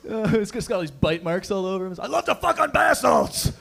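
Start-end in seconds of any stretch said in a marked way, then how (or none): 2.0-3.5 high, wild shout; hammed-up manic outburst; crazed drug user. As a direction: yell it like someone manic on bath salts. Voices the bath-salts user.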